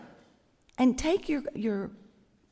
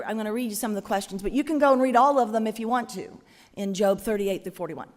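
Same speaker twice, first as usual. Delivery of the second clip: ordinary talk, nothing marked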